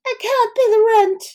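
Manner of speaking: high pleading victim voice; damsel in distress. Retold or imitated. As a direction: squeal it like a helpless heroine. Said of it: "vaudeville plays where you're like".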